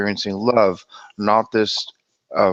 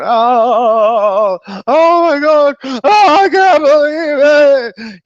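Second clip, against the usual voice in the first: hammed-up wailing scream, very high and loud; drawn-out, screeching fake sobs; crying and yelling; 10 dB louder, much slower than usual. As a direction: wail it out like a mock meltdown. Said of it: "crying and the yelling and the screaming".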